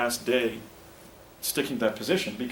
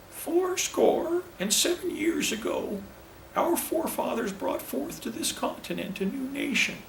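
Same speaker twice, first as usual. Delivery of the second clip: very high, slow, rural orator's voice; Lincoln impression; slower than usual. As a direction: stretch the words out in a high-pitched country voice. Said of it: "very rural and high-pitched".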